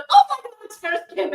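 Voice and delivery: imitating the girl's high, loud squeal; excited fan. The girl spotting Nurse Kim, imitated excited squeal. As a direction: squeal it like a starstruck kid. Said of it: "and she goes".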